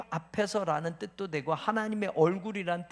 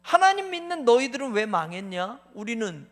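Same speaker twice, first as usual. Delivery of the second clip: taunting jeer; 4 semitones higher, 7 dB louder than usual. Acted. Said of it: "종일 듣는 말은".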